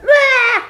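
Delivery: sudden high loud wail of tears; sobbing cry out. Retold or imitated. Woman recalling breaking down in the fitting room, loud sob cry. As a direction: burst into a sob. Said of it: "and cried".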